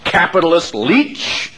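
ordinary delivery

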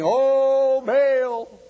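high, loud mock plea to a god; mock wailing cry. Preacher mimics Baal prophets calling out, high mock wail. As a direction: cry out to Baal like a frantic worshiper.

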